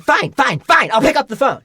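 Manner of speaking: high, loud mock outburst; exasperated giving-in. Student finally giving in to the ringing phone, exasperated. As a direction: burst out in exasperated surrender.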